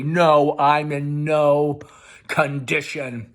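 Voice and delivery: loud then slowing, spat back; wild outburst. Acted. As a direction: hurl her words back at her. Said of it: "Tom wildly".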